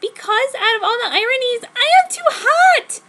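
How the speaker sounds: high, loud indignant outburst; very high indignant whine; angry. Acted. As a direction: spit it out in outrage. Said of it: "I was angry now".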